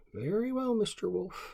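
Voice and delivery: high, loud resigned groundhog; sighing groundhog. Acted. Caged groundhog sighs to the wolf, high and loud. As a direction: give in with a high sigh.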